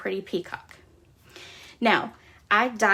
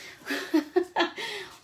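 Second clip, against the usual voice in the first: embarrassed laugh; 9 semitones higher than usual. Real, not acted. Laughs after the startle.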